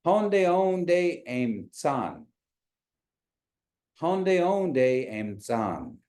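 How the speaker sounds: "Honde onde em san?" said very slowly, syllable by syllable; slow model. Shown as a demonstration. Teacher models the phrase slowly for online students. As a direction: spell it out slowly for them to repeat. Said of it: "try to say that".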